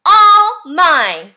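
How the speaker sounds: loud, possessive storybook yell; grouchy ladybug screaming, hammed up for children. A narrator's reading. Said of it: "screamed the grouchy ladybug".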